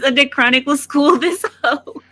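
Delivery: laughing through the words; giggling as she reads; reading while laughing. Real, not acted. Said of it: "it says".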